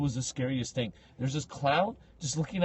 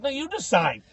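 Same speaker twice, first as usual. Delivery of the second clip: higher-pitched comeback at normal volume; insistent retort; 10 semitones higher than usual. Retold or imitated. Visitor to clown guide, insistent retort. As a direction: toss the choice back. First round of bickering with clown.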